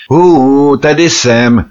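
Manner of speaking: loud, high hailing call; shout for attention. A narrator's reading. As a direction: call out sharply to people ignoring you. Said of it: "křikl jsem na ně". (hlasité zavolání, výkřik)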